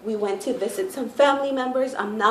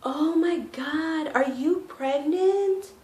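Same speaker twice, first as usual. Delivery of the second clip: high, slow, gushing mock voice; lilting mock of a coworker; high drawn-out feminine impression; imitating the guy; 7 semitones higher, much slower than usual. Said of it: "he's like".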